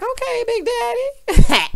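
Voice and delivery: high, loud, drawn-out teasing; hammed-up admiration breaking into a laugh; playful flirty approval. Acting her own impressed reaction.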